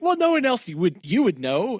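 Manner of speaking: a hint of a cagey hotel clerk; evasive reply. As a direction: dodge it with a sly, high voice. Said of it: "He's like".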